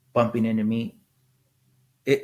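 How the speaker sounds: ordinary talk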